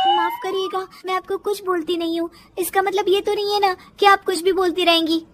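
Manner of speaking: high, quick protest; indignant daughter-in-law. Acted. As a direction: answer back politely but fast. Daughter-in-law answers back to mother-in-law.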